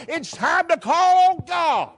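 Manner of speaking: high, slow, ringing call to the church; preaching cry. Real, not acted. Preacher rallying the congregation, slow, high and ringing. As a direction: lift it up high and stretch it out.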